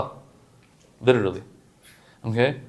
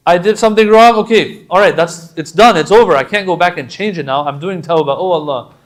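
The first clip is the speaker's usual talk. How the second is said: raised, pleading cry to God; loud repentant plea; 4 semitones higher, 9 dB louder than usual. Acted. Preacher voicing a sinner crying out to Allah, loud plea. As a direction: call out the confession, loud and urgent.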